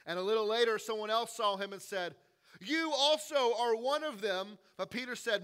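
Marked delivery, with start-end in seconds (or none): 2.6-4.6 accusation. Retold or imitated. Accuser in courtyard.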